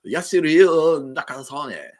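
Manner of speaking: hammed-up Australian Bogan accent, slow; Aussie Bogan accent. Shown as a demonstration. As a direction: ham up the Aussie Bogan on the name. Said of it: "sounds more like a Bogan".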